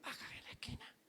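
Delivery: a soft whisper to the driver; whispered request. Retold or imitated. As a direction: whisper it so nobody hears. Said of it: "La gente hasta bajito decía".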